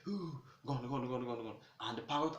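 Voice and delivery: ordinary delivery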